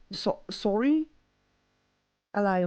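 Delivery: tentative Australian "sorry", rising at the end; attempted Aussie accent. Shown as a demonstration. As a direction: try "sorry" like an Australian, unsure.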